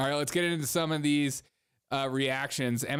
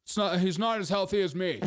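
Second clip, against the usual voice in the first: mock politician; 5 semitones higher than usual. Imagined Chris Christie quote.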